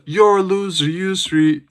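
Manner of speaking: high-pitched mock heckler's call; imitated heckle. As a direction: call it out like someone in the crowd. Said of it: "I heard someone in the prelims".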